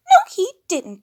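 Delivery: very high, loud insisting; shrill-pitched high insistence; Piggy insisting. A narrator's reading. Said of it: "insisted Baby Piggy".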